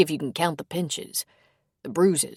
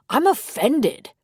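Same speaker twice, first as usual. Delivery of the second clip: mock offended; 8 semitones higher, 4 dB louder, slower than usual. A narrator's reading.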